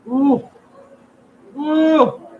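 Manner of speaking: high, loud ooh; hot food ooh. Real, not acted. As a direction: yelp at the heat.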